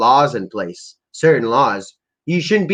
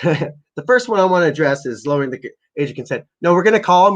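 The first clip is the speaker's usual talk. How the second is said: usual speaking voice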